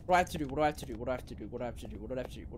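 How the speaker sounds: low, soft, repeated fretting; anxious muttering. Real, not acted. Player stuck in the game, muttering helplessly. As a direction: mutter it over and over.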